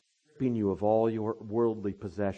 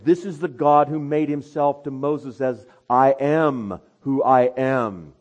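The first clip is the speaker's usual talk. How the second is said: loud, higher preaching voice; full-force sermon delivery; 6 semitones higher, 11 dB louder than usual. Acted. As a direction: preach it out to the congregation.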